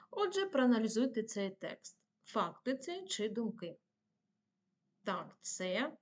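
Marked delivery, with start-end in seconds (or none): none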